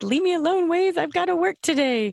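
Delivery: high, whiny plea; mock whine. Guest whining at Waze to leave her alone, high and whiny. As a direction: whine at the app like a nagging pest.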